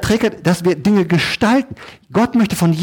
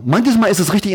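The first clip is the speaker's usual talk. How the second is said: normal talking voice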